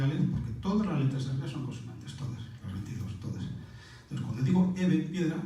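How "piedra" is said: usual speaking voice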